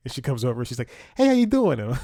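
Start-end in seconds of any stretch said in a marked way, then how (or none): 1.2-1.8 much higher, loud mom impression; New Jersey mom. Mom comes over at the pool, mock New Jersey mom. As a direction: holler a greeting like a caricature Jersey mom. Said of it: "straight out of New Jersey mom".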